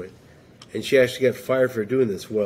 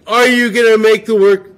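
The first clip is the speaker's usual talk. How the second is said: shouting all caps; 9 semitones higher, 10 dB louder than usual. Shown as a demonstration. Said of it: "To be yelling all the time?".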